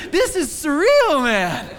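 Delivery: high, loud, slow laughing wonder; overwhelmed joy at the welcome; amazed laughing. Retold or imitated. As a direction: marvel out loud through a laugh. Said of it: "I was like".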